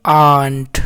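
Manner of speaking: आंट said low and held; sounded-out word. Shown as a demonstration. Teacher modelling आंट for students, said low and held. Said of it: "इस तरह से आंट पढ़ाएगा".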